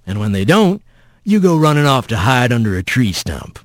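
low folksy lecturing, rising on key points; folksy rough voice. Acted. Mysterious voice confronts runaway Rat, folksy and chiding. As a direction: chide him like a backwoods elder.

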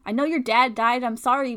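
loud sharp snap at him; angry outburst. Acted. Friend yelling at Owen to shut up, angry. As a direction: cut him off hard.

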